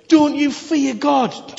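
high, slow and loud rebuke; slow him down with a hard rebuke; a criminal rebuking his cursing mate, sternly. Acted.

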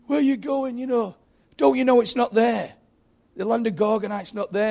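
put-on high-pitched, urgent child voice; little kid. Little kid calls to departing Gorgonites, high child voice. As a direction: plead like a worried little boy, voice pitched right up. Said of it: "And a little kid says".

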